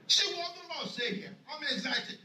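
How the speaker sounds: ordinary talk, nothing marked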